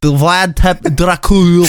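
Dracul in a mock Scottish-Schwarzenegger accent; a hint of a mangled Scottish accent; bad accent attempt. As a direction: muddle through a fake accent. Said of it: "really bad Schwarzenegger accent".

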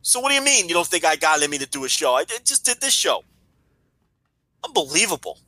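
high, exasperated protest; indignant rant. Real, not acted. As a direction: rant in disbelief, offended. Outraged at being doubted.

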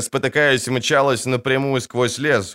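ordinary delivery

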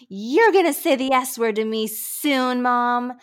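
a mild mock yell of the cheeky son; son yelling back. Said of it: "my son yelled back at me".

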